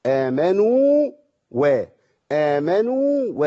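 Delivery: آمنوا with the واو stretched slowly before و; drawn-out recitation. Shown as a demonstration. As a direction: hold the واو of آمنوا its full length. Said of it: "أدي الواو المادية زمانها". (مد واو آمنوا)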